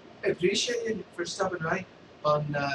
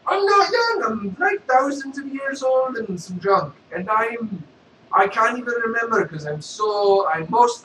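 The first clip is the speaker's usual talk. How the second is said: loud, high goofy Vader voice; a loud, silly Sith lord; Darth Vader; 8 semitones higher, 8 dB louder than usual. Acted. Vader answers beggar.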